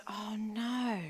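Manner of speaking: soft, half-whispered realisation; hushed dismay. Retold or imitated. Speaker recalls realising why her friend was upset, hushed dismay. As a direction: let it sink in under your breath.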